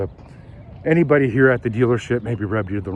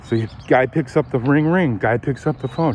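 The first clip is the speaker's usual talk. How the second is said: normal talking voice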